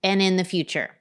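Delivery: steady, projected sponsor read; podcast ad read. Real, not acted. Host pitching life insurance to parents, polished ad read. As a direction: sell it with evenly emphasized key phrases.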